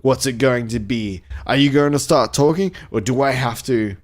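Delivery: mildly sneering villain challenge; taunting, impatient questions in a storybook sneer. A narrator's reading. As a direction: read it with a touch of a sneer. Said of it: "sneered Samantha Keylight".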